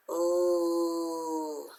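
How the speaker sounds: "L" as one long, steady hummed sound; voiced L. Shown as a demonstration. Teacher tests whether L is voiced, sustained voiced "L". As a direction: hold the sound of "L" on the voice, not the letter name. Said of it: "discover that it was voiced".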